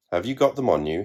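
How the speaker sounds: ordinary delivery, nothing marked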